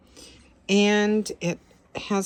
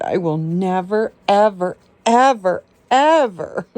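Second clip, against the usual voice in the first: each ever louder than the last; building promise; 6 dB louder than usual. Real, not acted. Promising the scared dog, each ever louder. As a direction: stack the evers, growing louder.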